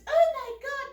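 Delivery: high, loud British exclamation; British accent. Retold or imitated. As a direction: imitate a shocked British woman. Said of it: "they were from Britain".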